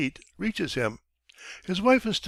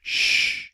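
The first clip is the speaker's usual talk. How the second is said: a sharp, breathless unvoiced shush; a scared wife silencing the flat; whispered shush; 3 dB louder than usual. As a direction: hush everyone without a voice. Wife hushing the household.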